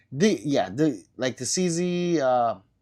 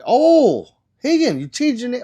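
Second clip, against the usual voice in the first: high, loud "oh" of surprise, much higher; surprised; 11 semitones higher, 10 dB louder than usual. Real, not acted. Streamer reacting to a chatter's new name, surprised and loud. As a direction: sound caught off guard by the name change.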